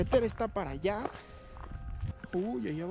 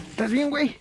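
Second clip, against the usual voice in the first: worried call; 7 semitones higher, 6 dB louder than usual. Real, not acted. Friend fell, calling out worried.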